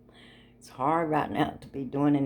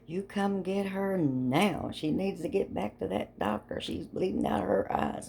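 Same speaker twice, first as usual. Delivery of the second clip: a mild imitation of her panicked yelling; mock scream; 7 semitones higher than usual. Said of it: "She starts a screaming like crazy".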